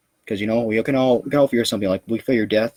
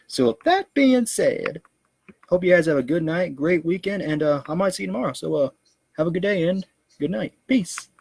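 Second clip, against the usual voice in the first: high goofy voice; 9 semitones higher than usual. Acted. High goofy outro sign-off.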